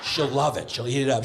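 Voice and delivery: usual speaking voice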